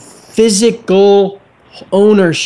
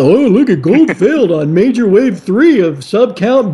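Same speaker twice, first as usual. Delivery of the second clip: mocking chart shill; 5 semitones higher than usual. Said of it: "and say".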